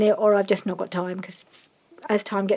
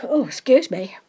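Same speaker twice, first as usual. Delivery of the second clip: right after a sneeze; breathless after sneezing; just sneezed; 3 semitones higher, 7 dB louder than usual. Real, not acted. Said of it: "excuse me, I'm gonna sneeze".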